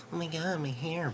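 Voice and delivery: high-pitched mock dismay; mock hair fussing. Mocking himself fussing over his hair, high-pitched dismay. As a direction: whine about it in a high, exaggerated voice.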